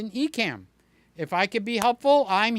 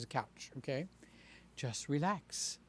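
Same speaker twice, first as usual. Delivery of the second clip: soothing ASMR; 5 semitones lower, 13 dB quieter than usual. Shown as a demonstration. Demoing his ASMR tone.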